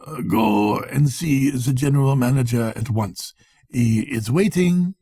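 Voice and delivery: a touch of agitation, lightly voiced; agitated. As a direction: urge him on, mildly flustered. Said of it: "he said in agitation".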